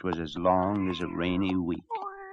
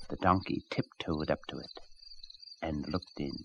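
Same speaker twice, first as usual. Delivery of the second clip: hushed narration; 6 semitones lower, 9 dB quieter than usual. A narrator's reading. Tiptoeing narration.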